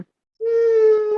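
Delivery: high, drawn-out sultry hum; mock sexy. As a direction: imitate her seductive "mm", hammed up. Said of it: "it is sexy when she's all like".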